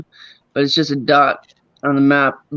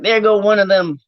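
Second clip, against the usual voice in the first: imitating the kid; 6 semitones higher than usual. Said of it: "the little kid was like".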